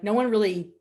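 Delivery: normal talking voice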